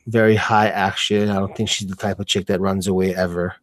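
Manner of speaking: ordinary talk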